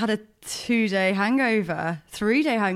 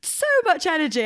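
mock perky; 8 semitones higher than usual. Host describing her friend's energy, mock cheery. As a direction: stretch it high, imitating someone bubbly.

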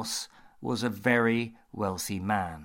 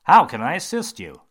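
bright, louder surgeon greeting; 6 semitones higher, 13 dB louder than usual. Acted. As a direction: greet the patient brightly, a bit higher. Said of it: "he said in a bright voice".

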